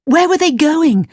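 put-on high, pressing girlish voice; urgent questioning. A narrator's reading. Gerda urgently asks after Kay.

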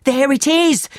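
high-pitched, excited exclamation at normal volume; excited cry. A narrator's reading. Thief pointing out the house to the leader, excited cry. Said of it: "spoke excitedly".

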